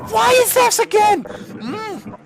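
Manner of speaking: very high, loud squeal; exasperated outburst. Real, not acted. Gamer complaining at the game, exasperated high squeal. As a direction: squeal the complaint at full volume.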